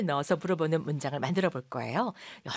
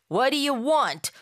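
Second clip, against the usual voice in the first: blunt demand; 7 semitones higher, 5 dB louder, much slower than usual. Shown as a demonstration. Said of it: "방실방실 웃으면서 하는 말 같진 않죠".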